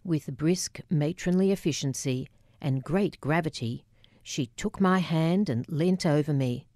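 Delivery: plain reading voice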